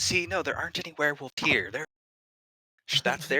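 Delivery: high, loud nervous denial. Acted. GM voices NPC denying.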